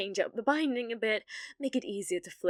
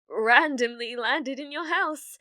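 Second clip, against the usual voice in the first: flustered; 10 dB louder than usual. Acted. Flustered excuse to shopkeeper.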